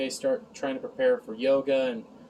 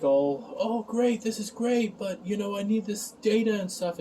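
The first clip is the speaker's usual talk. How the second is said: slightly put-on higher voice for the scientist; mock flustered quote; 7 semitones higher than usual. Bruce placating her while wanting his data, lightly mocked higher voice. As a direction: lightly imitate him, pitched up.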